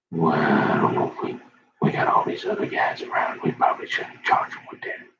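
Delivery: whispering officers conferring aside; hushed whisper. Retold or imitated. Retold officers whispering about whether to charge him. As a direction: keep it a low, breathless whisper between cops.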